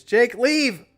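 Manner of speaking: high, loud playful shout; joking yell. Real, not acted. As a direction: shout him out of the room. Jokingly yelling at chat.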